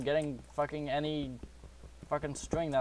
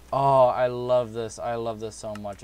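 delighted; 9 dB louder than usual. Real, not acted. Delight over diamonds.

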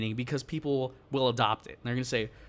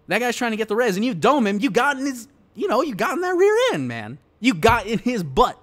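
higher and louder, gleeful hype; gleeful gamer hype, pitch up high; hyped; 5 semitones higher, 6 dB louder than usual. Real, not acted. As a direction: get hyped over the kill. Hyped retelling of game kill.

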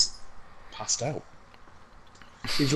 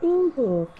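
mock delighted ooh; 14 semitones higher, 4 dB louder than usual. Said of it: "You're like".

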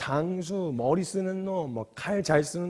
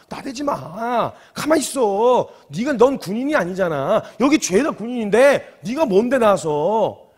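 louder, higher telling-off; scolding; 5 semitones higher, 7 dB louder, slower than usual. Acted. Soldiers scolding young David to stay back, sharp scolding. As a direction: put an upstart in his place, stretching the endings. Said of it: "주변 사람들이 다윗을 말리기 시작했어요".

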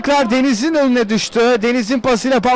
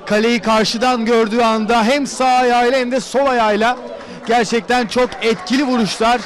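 ordinary delivery

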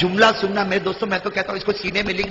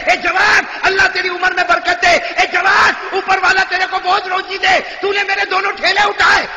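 put-on old woman's shouted blessing; 11 semitones higher, 5 dB louder than usual.

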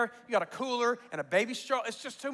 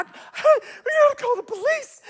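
much higher, slow, alarmed mother; panicked mom voice; 14 semitones higher, 6 dB louder than usual. Retold or imitated. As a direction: squeal it like a startled mom. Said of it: "My mom's like".

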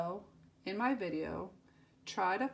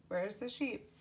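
ordinary talk, nothing marked

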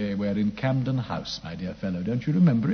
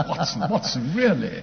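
laughing; 5 semitones higher, 4 dB louder than usual. Acted. Holmes laughing at Watson.

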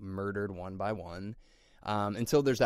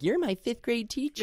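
high-pitched mock film dialogue; mock porno line; 14 semitones higher, 3 dB louder than usual. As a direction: quote it high, like a cheesy actor. Mocks line from porno scene.

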